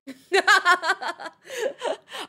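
high, loud cackle; burst of laughter. Real, not acted. Streamer cracking up over a nickname, high laughter. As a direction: crack up for real.

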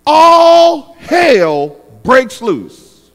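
loud, very slow emphasis; drawn-out climax. Real, not acted. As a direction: stretch each word out for dramatic impact. Dramatic climax of the story.

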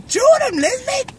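high, loud Cajun call; cajun accent. Retold or imitated. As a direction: shout it Cajun style. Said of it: "I say it with Troy".

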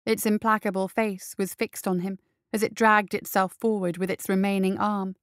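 ordinary delivery

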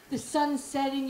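normal talking voice

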